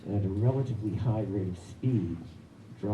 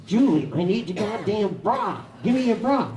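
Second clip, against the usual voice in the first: loud, higher, impatient yelling; insistent yell; 11 semitones higher, 5 dB louder than usual. Retold or imitated. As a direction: demand it again, fed up. Biker repeats demand to wife.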